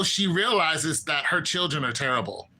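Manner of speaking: usual speaking voice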